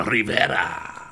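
mock Spanish-style accent with a tapped R; hammed-up Spanish-style accent on the name; sudden put-on accent. Recalling where Kyle's accent came from, sudden put-on accent. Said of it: "Kyle has an accent for two words".

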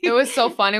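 ordinary delivery

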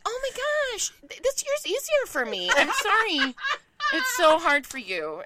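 high, gushing mock sympathy; imitating a pitying school parent; sympathetic other parents.

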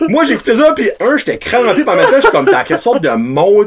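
laughing, amazed at the story; laughing disbelief. Real, not acted. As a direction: laugh while telling it, amazed. Laughing over absurd story.

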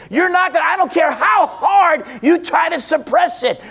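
fiery sermon climb, voice lifted; preaching at full force. Acted. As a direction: drive it home from the pulpit, a mild shout.

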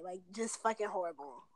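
usual speaking voice

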